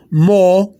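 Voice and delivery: mo with a single long, held O; drawn-out mo, one sustained O. Shown as a demonstration. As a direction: stretch the O in mo into one long vowel. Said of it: "You're not saying one long O".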